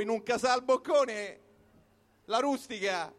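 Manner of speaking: normal talking voice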